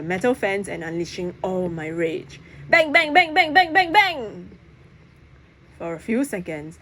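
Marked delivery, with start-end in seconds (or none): 2.7-4.5 quick string of bang sounds; rapid bangs. A narrator's reading. Narrator unleashing rage with a burst of bangs. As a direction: fire them off one after another.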